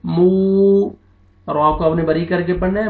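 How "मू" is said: मू held long with fully rounded lips. Shown as a demonstration. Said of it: "lips को आपने round में". (मू को होंठ गोल करके खींचकर)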